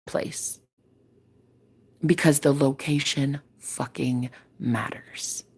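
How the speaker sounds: slow, hushed whisper for emphasis; emphatic whisper. Real, not acted. Stressing to viewers that location matters, in a slow emphatic whisper. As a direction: whisper it slowly, leaning in.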